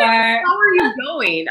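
slow mock Aussie greeting.

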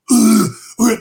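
loud strained retching growl, high and harsh; terrible growl vocals. Retold or imitated. Showing his awful first try at metal vocals, loud strained growls. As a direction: bark out a bad first metal scream. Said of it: "mine was like".

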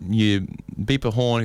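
normal talking voice

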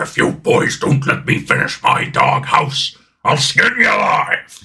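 slow cartoon-dog threat, put-on voice; Doghouse Dog. Cartoon dog warns the boys off, slow threatening put-on voice. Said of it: "that's my character".

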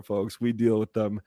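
ordinary talk, nothing marked

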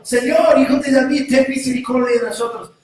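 high, loud, fast plea; shouting. Acted. Blind men crying out again to Jesus, loud plea. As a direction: cry out for mercy quickly. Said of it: "gritaron aún más fuerte".

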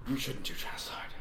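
whispered aside. Shown as a demonstration. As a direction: hush it to a whisper. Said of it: "said twice, second whispered".

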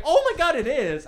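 a laughing high shriek that settles down; excited. Retold or imitated. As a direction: squeal in disbelief and delight. Girlfriend's excited reply retold.

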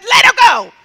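very high, shouted order; insistent command. Retold or imitated. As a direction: shout down their objection. Healer overrules the protest.

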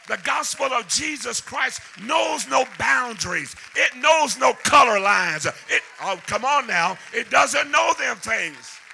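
hammering preacher at full voice; full-force preaching. Acted. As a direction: whoop it out to the congregation. Preaching climax.

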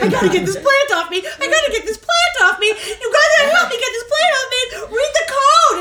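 high, loud, laughing panic; mock terrified plea, laughing through; panicked screaming. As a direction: scream like the plant grabs you. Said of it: "now I'm screaming".

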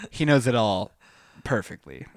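ordinary delivery

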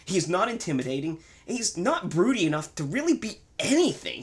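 high, bouncy presenter voice; hyped review host. Acted. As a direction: sell the rant like a show host.

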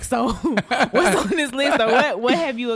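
laughing, loud and slow; eager nosy question through laughs. Pretend. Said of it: "I'm gonna be like".

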